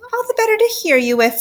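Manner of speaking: high, quick mock-granny voice; falsely sweet falsetto; wolf posing as grandma. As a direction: coo it high as a disguised wolf. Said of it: "said the wolf".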